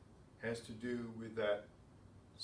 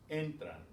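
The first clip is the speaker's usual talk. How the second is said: "entran" with the first syllable "en" stressed and higher; stress on next-to-last syllable; 4 semitones higher than usual. Shown as a demonstration. Teacher reads words ending in N, "entran" stressed on "en". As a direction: lean on "en" in "entran". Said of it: "following the same rule".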